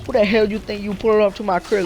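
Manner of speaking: lightly imitated lady with attitude.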